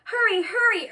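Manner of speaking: high, loud, urgent call; urging. A narrator's reading. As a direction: push a friend to get moving. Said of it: "urged Bear".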